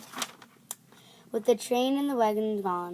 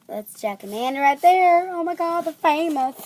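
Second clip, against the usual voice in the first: excited; 7 semitones higher, 7 dB louder than usual. Real, not acted. Excited at seeing picture.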